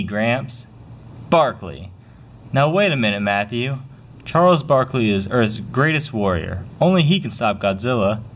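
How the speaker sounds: usual speaking voice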